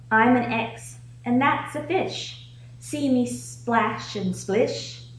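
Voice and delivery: ordinary talk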